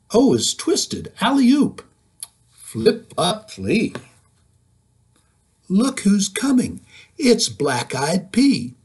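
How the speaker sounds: put-on lively, sing-song read-aloud for kids; reading to children. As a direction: read it bouncy, like storytime. Reading picture book aloud.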